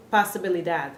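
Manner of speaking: POSIBILIDAD with English vowels, "pah-suh-bil-i-dad"; heavily English-accented POSIBILIDAD, O turned to ah; heavy English accent. Shown as a demonstration. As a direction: mispronounce POSIBILIDAD like English possibility. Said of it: "with a heavy accent to them".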